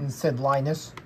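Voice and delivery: usual speaking voice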